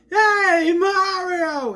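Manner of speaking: high, loud, drawn-out call; imitating a piercing holler; mock yell. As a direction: yell it out obliviously, giving the hiding spot away. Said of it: "yells out".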